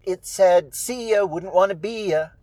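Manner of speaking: put-on cheeky young reply; frivolous. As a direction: toss it off in a light singsong. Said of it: "she says something frivolous".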